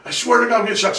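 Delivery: high-pitched mock pleading; exasperated son, re-enacted; retold desperate insistence. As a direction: insist you mean it, hammed up. Son insisting in acted quarrel.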